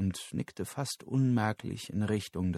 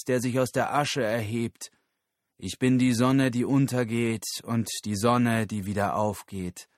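messianic guru; 3 semitones higher, 4 dB louder than usual. Acted. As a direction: declaim like a prophet to the crowd. Guru verkündet seine Auferstehung. (pathetisch verkündender Guru)

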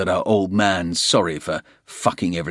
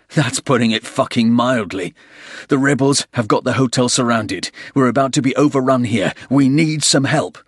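loud, urgent yell over gunfire; shouting; 5 semitones higher, 5 dB louder than usual. Acted. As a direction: shout above the battle noise. Porter yelling over gunfire.

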